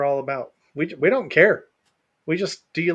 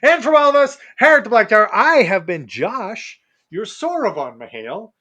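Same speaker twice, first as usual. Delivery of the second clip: announcer sign-off; 5 semitones higher, 7 dB louder than usual. Acted. Formal podcast sign-off.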